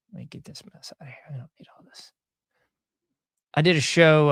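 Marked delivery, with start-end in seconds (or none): 0.1-2.1 whispered to himself while clearing the screen; whispered aside. Real, not acted. Host tidying his screen, whispering to himself. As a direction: say it under your breath, to yourself.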